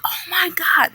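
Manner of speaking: high horrified oh my God; shocked gasp words. Retold or imitated. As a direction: react in horror at the sight. Sees birds attacking people.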